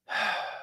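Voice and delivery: soft breathy last exhale; imitated final sigh, voiceless; dying breath. Author imitates her as she goes, soft last sigh. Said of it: "she just goes".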